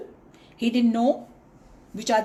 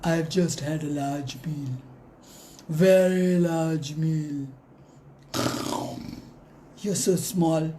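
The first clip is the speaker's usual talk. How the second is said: lion voice; 5 semitones lower than usual. Acted. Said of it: "So the lion said".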